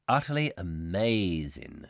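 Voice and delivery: normal talking voice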